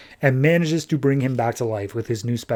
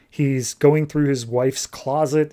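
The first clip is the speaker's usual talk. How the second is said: normal talking voice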